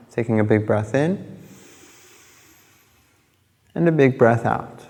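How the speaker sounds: unhurried phrases, leaving space between each instruction; guided meditation. Acted. Yoga teacher guiding listeners through breathing and body awareness, calm meditation voice.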